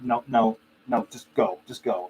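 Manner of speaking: usual speaking voice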